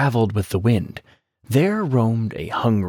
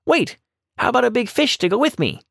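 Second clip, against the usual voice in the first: much higher, fast and eager rabbit voice; quick rabbit; 12 semitones higher, faster than usual. A narrator's reading. Rabbit stops the tiger with a fast, high eager offer. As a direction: blurt it out to stall the tiger.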